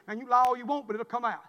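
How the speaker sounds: usual speaking voice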